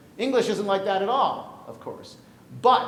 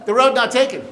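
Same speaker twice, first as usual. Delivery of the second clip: loud, high announcement of the title; announced title; 7 semitones higher, 14 dB louder than usual. Real, not acted. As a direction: proclaim the title ringing out. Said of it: "announcing poem title".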